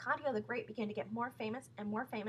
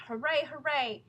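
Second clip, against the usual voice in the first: louder crowd cheer; 5 semitones higher, 7 dB louder, slower than usual. A narrator's reading. Audience cheering as Lafcadio comes out. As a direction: cheer as the star appears. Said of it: "everyone went".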